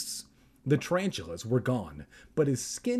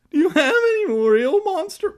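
very high, loud strained squeal; attempted voice crack; 11 dB louder than usual. Reader retries Evan's line, straining for a voice crack. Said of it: "I can't make my voice crack".

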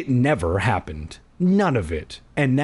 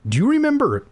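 sister voiced; 13 semitones higher than usual.

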